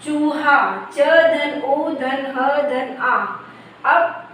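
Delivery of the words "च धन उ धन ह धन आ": very slow, each letter spelled apart; drawn-out phonics breakdown; slow letter-by-letter spelling. Shown as a demonstration. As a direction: spell it out slowly for children. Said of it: "तो च के बाद उ आया".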